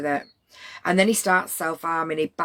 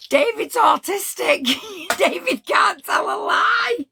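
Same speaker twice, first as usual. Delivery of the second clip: higher, louder words through real laughter; laughing hard; 5 dB louder, slower than usual. Real, not acted. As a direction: burst out laughing as you talk.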